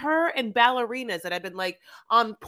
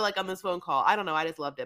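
ordinary talk

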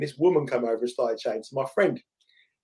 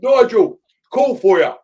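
put-on loud, high call-out in a London accent; common London accent; 8 semitones higher, 8 dB louder, slower than usual. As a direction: holler it like a London trader. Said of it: "like, a, quite a common, like, London accent".

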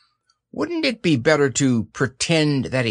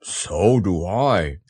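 slow, lower bear voice; low, drawn-out reply; Pooh; 5 semitones lower, much slower than usual. A narrator's reading. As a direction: say it slowly as a simple, fond bear. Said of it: "said Pooh".

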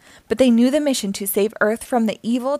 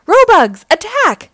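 high, loud battle order; shouted command; 10 semitones higher, 8 dB louder than usual. A narrator's reading. Dreg orders his Robugs, shouted command. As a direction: shout the order with full force. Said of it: "shouted Dreg".

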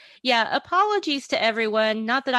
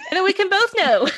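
high, loud, laughing delivery; 12 semitones higher, 6 dB louder than usual. Real, not acted. Host capping a silly wordplay with her co-host, laughing. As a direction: say it through laughter, pitched up and louder.

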